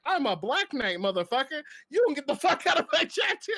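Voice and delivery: hammed-up chatter bragging; mock tough guy. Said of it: "He was like".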